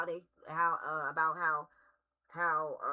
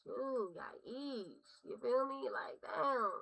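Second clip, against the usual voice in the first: real crying; 6 semitones higher, much slower than usual. Real, not acted. Reader overwhelmed by heartbreak card.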